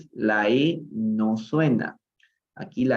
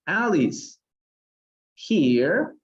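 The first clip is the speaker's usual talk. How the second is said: calling out; 5 semitones higher, much slower than usual. A narrator's reading. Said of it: "cried Alice".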